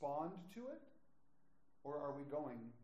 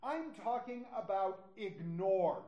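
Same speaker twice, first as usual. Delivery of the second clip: "ignore" slow and louder; stress on ignore; 3 semitones higher, 7 dB louder, slower than usual. Real, not acted. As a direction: lean hard on "ignore". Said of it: "not talking about just a slightly different interpretation".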